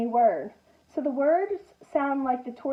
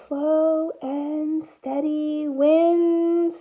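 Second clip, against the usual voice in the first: slow refrain; much slower than usual. Acted. Reading the refrain slowly.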